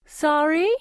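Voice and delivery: put-on high piping Capitol accent, rising; silly Capitol accent. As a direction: pipe it in a prissy Capitol accent. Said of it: "she pipes in her silly capital accent".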